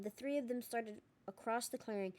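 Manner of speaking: ordinary delivery, nothing marked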